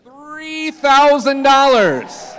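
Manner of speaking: slow, high, loud bid call; auctioneer shout. Real, not acted. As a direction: belt out the big number.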